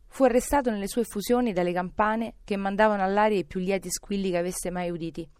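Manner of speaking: ordinary delivery, nothing marked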